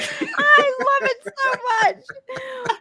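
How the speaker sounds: high, slow, through laughter; laughing. Real, not acted. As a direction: gush while cracking up. Laughing at green screen joke.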